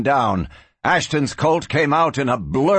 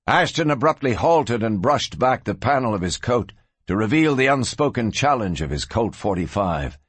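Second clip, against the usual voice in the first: ordinary delivery, nothing marked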